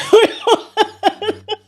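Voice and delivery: high breaking laughter; helpless laugh. Real, not acted. Streamer overcome by her own mishap, high helpless laugh. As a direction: laugh until it runs out into a squeal.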